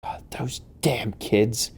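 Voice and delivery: slow, hushed, breath-heavy grumble; exasperated mutter. Acted. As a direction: grumble it softly under your breath, slowly. Game master voices exasperated orphanage keeper.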